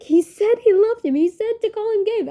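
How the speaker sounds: high, loud, tearful wail of a quote; hammed-up memory of crying in the bathroom; retold crying. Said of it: "I was literally crying in the bathroom".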